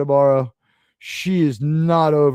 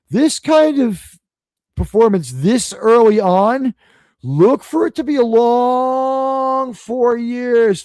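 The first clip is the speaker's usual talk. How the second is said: stretched out high and slow; mock-ominous, drag it out; sarcastic slow drawl; 5 semitones higher, slower than usual. Warning viewers about the mayor, slow and higher.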